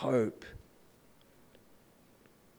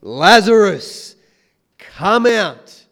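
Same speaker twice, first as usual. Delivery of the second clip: a slow, loud summons, higher than usual; loud call; 5 semitones higher, 11 dB louder, much slower than usual. Retold or imitated. Jesus calling Lazarus out of the tomb, loud and slow. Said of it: "He just calls out in a loud voice".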